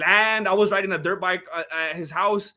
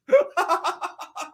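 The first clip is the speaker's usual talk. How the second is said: high laugh; 9 semitones higher than usual. Real, not acted. After the shouting.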